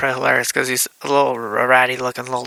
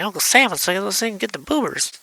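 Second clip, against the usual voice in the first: higher imitated kid voice; urging an uncle; 8 semitones higher than usual. Child urges uncle.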